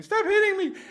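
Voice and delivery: very high, loud squealing scream; imitated scream. Teenager begging the kid with the stick, shrill shriek. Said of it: "the kids over there screaming".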